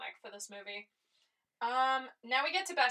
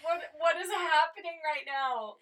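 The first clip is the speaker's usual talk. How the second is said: baffled reaction; 5 semitones higher than usual. Retold or imitated. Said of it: "I was like".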